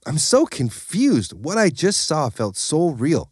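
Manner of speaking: puzzled over a vision; mild bafflement; baffled. A narrator's reading. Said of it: "Deluxe sat there baffled".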